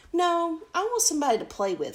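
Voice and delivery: high, loud and fast protest; eager princess. Acted. Princess pleads with her parents, high and fast. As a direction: rush it out high like a bored child. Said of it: "said the Princess".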